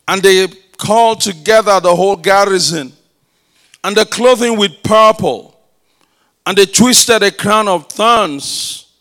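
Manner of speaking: loud, forceful scripture reading; preaching scripture. Acted. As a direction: proclaim the passage to the whole church. Reads scripture at full force.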